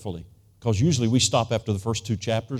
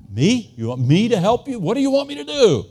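high, loud, incredulous yelp of surprise; a father stunned at being asked to help; startled disbelief; 10 semitones higher, 7 dB louder than usual. Retold or imitated. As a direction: caught off guard, voice jumps up. Startled when the doctor asks him.

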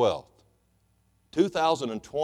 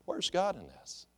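the question asked much softer and slow; quiet; 6 semitones higher, 9 dB quieter than usual. Shown as a demonstration. Pastor asking the congregation again, quietly and slowly. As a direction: let the question drop to a soft hush. Repeated question, dropped soft.

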